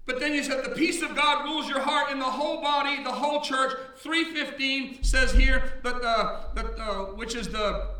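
full-force sermon delivery; preaching. Acted. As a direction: preach it to the congregation. Preacher back in full flow.

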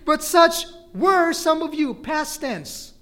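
"were" punched high and loud; stress on WERE. Shown as a demonstration. Preacher stressing the past tense, stress on "were". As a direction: hammer "were" at full preaching volume.

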